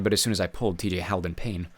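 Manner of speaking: plain reading voice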